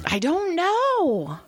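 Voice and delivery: high, drawn-out protest; slow high-pitched wail of innocence; exasperated bafflement. Real, not acted. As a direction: stretch it out, genuinely clueless. Answering what she did wrong.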